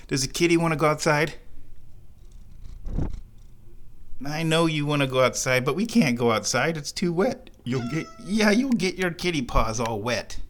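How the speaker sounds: high, gentle pet talk; lightly cooing to cat. Real, not acted. Cat wants to go outside.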